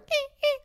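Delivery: high whimpering yelp like a hurt dog; imitating her hurt dog's high whines. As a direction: squeak it like a scared little dog. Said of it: "I heard her, like".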